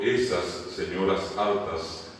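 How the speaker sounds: "Esas señoras altas" drawn out, plural endings clear; slow example, word by word in "Esas señoras altas"; slow dictation. Shown as a demonstration. Example phrase dictated for translation.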